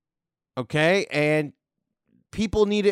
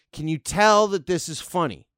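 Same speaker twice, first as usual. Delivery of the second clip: ordinary delivery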